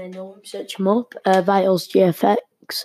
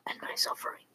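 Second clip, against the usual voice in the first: whispered aside; 15 dB quieter, faster than usual. Real, not acted. Self-pitying aside after laughing.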